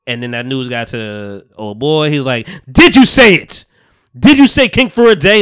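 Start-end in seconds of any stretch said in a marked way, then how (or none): 2.7-5.4 loud high furious demand; angry king. Retold or imitated. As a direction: bark it in outrage. Angry king confronting brother.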